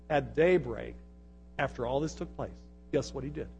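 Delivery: low, soft, intimate aside; quiet, reverent storytelling; hushed. Real, not acted. Hushed aside inside the reading.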